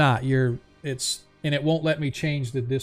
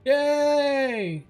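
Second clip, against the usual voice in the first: high excited cheer; 12 semitones higher, 5 dB louder than usual. Real, not acted. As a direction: cheer out for him. GM cheers for a viewer.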